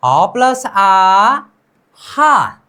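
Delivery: ह plus आ drawn out slowly, landing on हा; sounding out letters. Shown as a demonstration. Teacher joins two letters for learners, sounding them out. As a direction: stretch the आ, then blend it into हा. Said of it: "नीचे यहाँ पर क्या है?".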